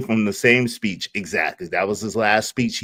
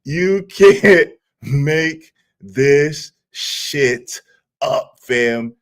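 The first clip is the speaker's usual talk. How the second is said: very slow, one word at a time through laughter; laughing disbelief; 3 semitones higher, much slower than usual. Real, not acted. Streamer repeating it to viewers, laughing in disbelief. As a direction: break "You can't make this shit up" into separate drawn-out words. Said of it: "the reason why I'm laughing so hard".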